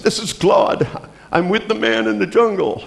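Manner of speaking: high-pitched imitated caller from the jungle; dzwoniący z dżungli. Relayed voice on jungle phone call.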